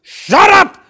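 very high, full-force scream; furious screaming. Acted. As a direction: scream right into someone's face. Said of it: "got right in my face".

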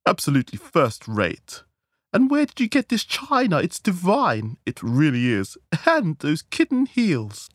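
high, gushing society-lady raptures; rapturous approval. A narrator's reading. Guests rave over the hostess's table, rapturous. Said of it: "transformed into rapturous approval".

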